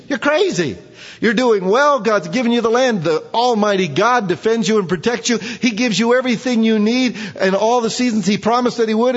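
high, loud exasperated scolding; exasperated rebuke. Real, not acted. Preacher scolds Israel for rejecting God, high and loud. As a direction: shake them for being foolish.

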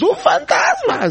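high, slow laughing mock scream of fright; hammed-up terrified shout; mock frightened cry. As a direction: squeal it like scared disciples, laughing. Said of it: "empiezan a gritar, asustadísimos".

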